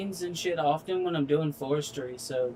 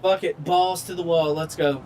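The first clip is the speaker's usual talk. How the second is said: rowdy give-up; 2 semitones higher than usual. Real, not acted. Giving up, rowdy.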